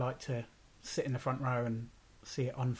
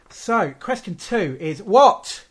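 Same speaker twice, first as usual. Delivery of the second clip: shouting; 7 semitones higher, 12 dB louder than usual. Real, not acted. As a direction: yell the question at the microphone. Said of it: "stop shouting into the microphone".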